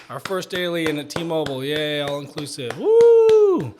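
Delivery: "woo" in a high, shrieking whoop; mock cheer. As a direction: ham up a corporate cheer ending in a squeal. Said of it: "they would be like".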